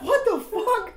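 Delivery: very high loud shocked laugh; stunned, laughing. Retold or imitated. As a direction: disbelief cracking into laughter.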